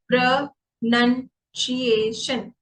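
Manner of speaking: प्रोनंसिएशन broken slowly into separate syllables; split into syllables. Shown as a demonstration. Teacher showing how the word divides, slow separated syllables. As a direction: say प्रोनंसिएशन syllable by syllable, pausing between parts. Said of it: "को मैंने ऐसे divide किया हुआ है".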